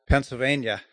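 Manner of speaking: ordinary talk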